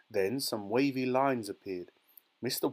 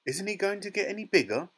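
high, fast, raised question; alarmed shout; 9 semitones higher, 4 dB louder, faster than usual. A narrator's reading. Father worries his son will stay tiny, shouting. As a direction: shout it quickly, pitched up. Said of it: "shouted Mister Teavee".

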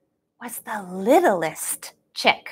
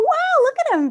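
usual speaking voice

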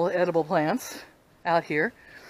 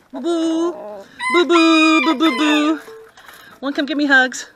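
cooing to a pet; 4 semitones higher, 3 dB louder, slower than usual. Real, not acted. Cooing to rooster.

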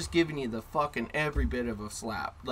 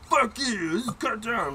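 mock angry kid; 8 semitones higher, slower than usual. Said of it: "he'd be like".

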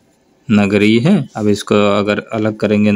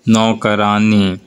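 slow demo word; much slower than usual. Shown as a demonstration. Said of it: "देखिए यहाँ पे आनी आ गया".

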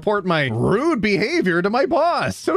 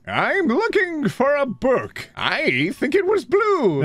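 high, drawn-out customer voice; acted vague customer; 6 semitones higher, slower than usual. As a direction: play a clueless customer, slow and pitched up. Acted customer in a bookshop strip.

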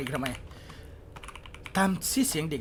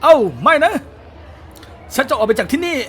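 panicked cry; 9 semitones higher, 13 dB louder than usual. Real, not acted. Being caught by bullies in game.

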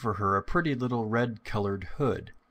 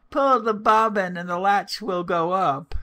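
high, loud grandmotherly call; old woman calling out; 10 semitones higher, 7 dB louder than usual. A narrator's reading. Old woman in bed calling to the door, high loud call. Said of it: "The good old woman, who was ill in bed, called out".

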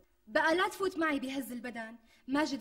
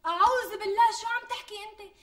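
horrified exclamation; 8 semitones higher, 6 dB louder than usual. Acted. Wife horrified at stealing.

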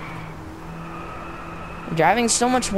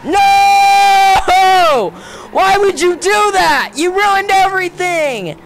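much higher, louder, slow accusing shout; slow higher furious shout; outraged yelling; 9 semitones higher, 6 dB louder, much slower than usual. Real, not acted. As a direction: rage at the game for ruining it. Game resets his run.

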